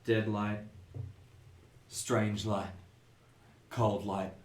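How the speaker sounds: understated eerie muttering. Acted. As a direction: mutter each phrase separately, as if entranced. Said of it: "She is muttering".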